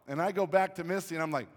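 ordinary delivery

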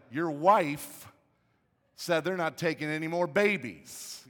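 loud, slow, pointed accusation; accusing boss. Retold or imitated. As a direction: imitate an irate pastor laying blame. Pastor blames him for wife's decision.

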